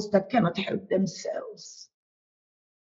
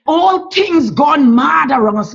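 louder burst of fervent pleading; rising plea; 3 semitones higher, 7 dB louder than usual. Real, not acted. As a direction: swell the voice as you beg. Prayer swells into a plea.

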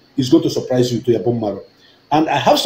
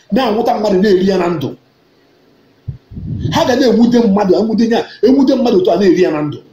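impassioned declamation; 5 semitones higher than usual. Real, not acted.

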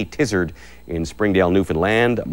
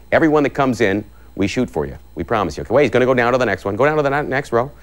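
ordinary talk, nothing marked

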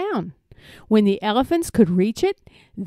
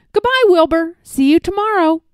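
higher, louder breezy farewell; calling goodbye; 7 semitones higher, 5 dB louder than usual. A narrator's reading. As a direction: call a teasing goodbye. Teasing farewell while walking away.

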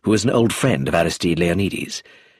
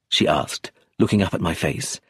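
ordinary delivery

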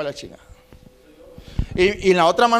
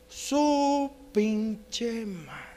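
extremely slow, hushed drawl; soft, drawn-out mock awe; awestruck drunk; 12 dB quieter, much slower than usual. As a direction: stretch each word out in soft amazement. Drunk grandfather's reaction to attractive stewardess.

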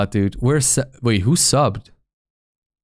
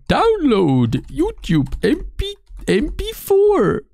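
very high, slow squeaky voice; goofy high-pitched drawl; silly put-on voice; slower than usual. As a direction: stretch it out absurdly high. Silly voice while typing a search.

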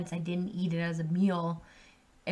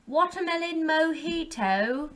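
slow, higher and louder, savoring the name; drawn-out announcement; 7 semitones higher, 6 dB louder than usual. Real, not acted. Vlogger crowning the best seltzer with a big announcement. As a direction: announce the winner with flair. Dramatic ranking reveal.

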